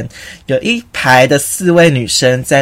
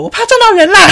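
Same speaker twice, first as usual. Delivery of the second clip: much higher and louder, blurting it out; exasperated; 5 dB louder than usual. Retold or imitated. Repeating the excuse to a friend, exasperated outburst. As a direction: lose patience and blurt.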